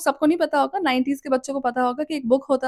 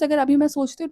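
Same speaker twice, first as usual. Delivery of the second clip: ordinary delivery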